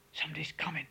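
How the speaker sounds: breathless whispered warning; urgent whisper in the dark; hissed whisper. A narrator's reading. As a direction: hiss it under your breath. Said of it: "I hissed a blow my breath".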